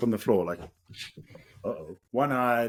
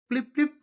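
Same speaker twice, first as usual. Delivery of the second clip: much higher, chirpy car-key beep imitation; imitated car beep. Hearing his lost car answer the key, high beep imitation. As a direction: mimic the remote locking beep. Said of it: "I just hear".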